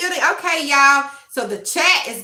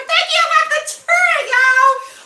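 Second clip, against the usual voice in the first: high, louder squealed thanks; excited squeal; 6 dB louder than usual. Real, not acted. Streamer squealing thanks to chat about her chair. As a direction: squeal with delight at the praise.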